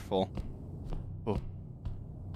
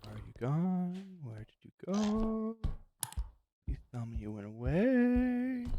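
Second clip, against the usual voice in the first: soft, high, scared; scared whisper-quiet; 7 semitones higher, 8 dB quieter than usual. Real, not acted. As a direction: hush it, hoping it left. Hiding from a monster.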